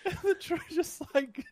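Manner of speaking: gloating through laughter; cackling it out, drawn out; laughing. Real, not acted.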